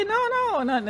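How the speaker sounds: very high, squeaky mock reply; mock denial. Mimicking Joanna's flustered denial in a very high squeak.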